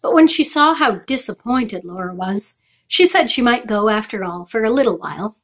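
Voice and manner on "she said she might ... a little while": plain reading voice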